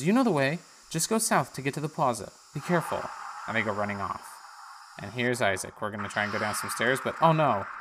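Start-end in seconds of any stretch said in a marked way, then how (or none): 0.0-3.1 higher, louder motherly voice; instructing then warning; Dora. Acted. Dora sending Isaac south, higher voice. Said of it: "And she goes".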